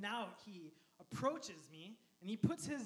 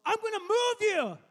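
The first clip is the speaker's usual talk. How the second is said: yelling threat; 12 semitones higher, 14 dB louder than usual. Acted. Driver threatening the man in his way, yelling. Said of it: "then he yells".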